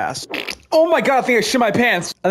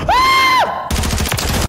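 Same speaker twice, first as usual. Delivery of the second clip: startled shriek. Real, not acted. Scared shriek.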